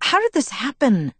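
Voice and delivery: high-pitched, mild complaint; grumbling. A narrator's reading. Bella complains about how tonight turned out, grumbling. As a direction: grumble it as a put-upon question. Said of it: "I grumbled".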